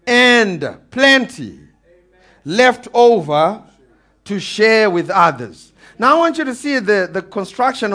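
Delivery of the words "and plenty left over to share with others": slow, spaced-out delivery with pauses; slow emphasis. Real, not acted. Preacher stressing the word plenty, slow with pauses. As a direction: drag it out word by word.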